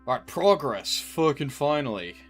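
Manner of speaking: relieved loud cheer; loud relief. Real, not acted. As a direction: shout it out, finally relieved. Relief at progress.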